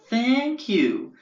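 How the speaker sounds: put-on high, loud-and-proud Long Island boyo; Long Island accent. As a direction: gush it in Long Island-ese. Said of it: "real Long Island boyos".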